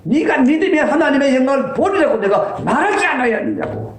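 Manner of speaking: preacher voicing a stern Jesus; rebuking Jesus. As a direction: thunder the rebuke at the doubters. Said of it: "예수님이 호통을 치신 거예요".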